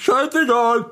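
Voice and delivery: put-on very high squeaky kid voice; Infantino voice. Shrugging off the warning, as a squeaky cartoon kid. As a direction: toss it off like a cheeky child. Character voice.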